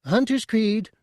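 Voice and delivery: very high squeak; squeaky Chadrafan. A narrator's reading. Tooty stopping Bosk from shooting, a high squeak. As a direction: squeak it out in alarm. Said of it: "Tooty squeaked".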